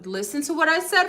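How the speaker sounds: high-pitched, insistent demand for attention; urging listeners to take note; emphatic insistence. Real, not acted. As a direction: make them listen, pitch lifted. Repeats key point for emphasis.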